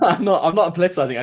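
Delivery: laughing denial. Real, not acted. Co-host laughing off being called political, loud and amused. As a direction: protest while cracking up.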